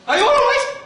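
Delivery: high, loud sing-song check-in; shrill camp greeting. Acted. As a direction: hostess squeal to the crowd. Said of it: "That's my way of saying hello".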